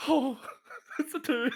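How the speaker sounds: squeaky high laugh through the words; real giggle at a terrible roll. Real, not acted. Player laughing at rolling a two, giggling. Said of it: "laughing at bad roll".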